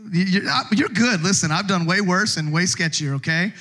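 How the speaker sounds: ordinary talk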